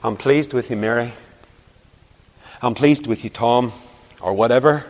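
soft, low, tender approval; the Lord's gentle voice. Retold or imitated. The Lord speaking to the believer at bedtime, soft and tender. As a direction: whisper it like a loving father. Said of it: "the Lord's voice saying to you".

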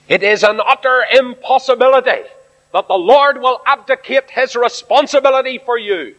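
emphatic preaching. Acted. Preacher at full force.

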